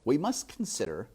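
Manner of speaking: plain reading voice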